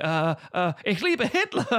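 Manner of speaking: stammer, then blurt bad German; hammed-up desperate American attempting German; American faking German. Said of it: "there's an American saying".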